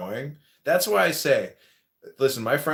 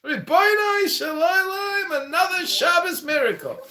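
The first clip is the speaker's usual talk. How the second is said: very high, loud, stretched-out exclamation; hammed-up cry of rejoicing, way up in pitch; mock jubilation; 5 dB louder than usual. Roaster hails a Shabbos miracle, mock jubilant.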